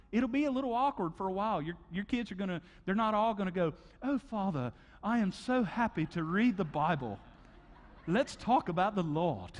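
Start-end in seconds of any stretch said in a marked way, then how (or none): 4.0-9.5 sarcastic, overly sweet delight with a laugh; hammed-up cheerful child; mock kid enthusiasm. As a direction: play the kid faking joy about Bible time. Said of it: "No, that's not gonna happen".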